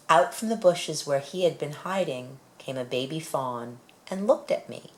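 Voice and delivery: plain reading voice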